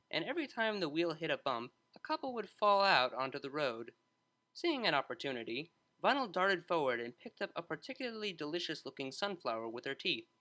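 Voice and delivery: plain reading voice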